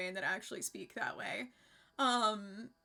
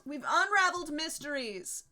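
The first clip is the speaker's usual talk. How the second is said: UNRAVELED swelling high and loud; higher, louder, mock-grand proclamation; triumphant repeat; 4 semitones higher, 5 dB louder than usual. Real, not acted. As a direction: announce the solved mystery grandly, leaning into "unraveled".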